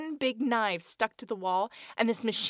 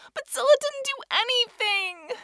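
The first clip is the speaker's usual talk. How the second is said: tearful protest; 7 dB louder than usual. Acted. Amy fighting tears protests.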